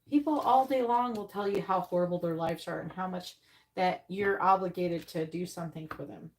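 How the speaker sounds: normal talking voice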